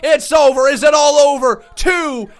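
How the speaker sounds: high, very loud excited commentary; full-voiced ringside shout; shouted match call. Real, not acted. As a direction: yell the near-fall as if it ends the match. Shouting a near-fall live.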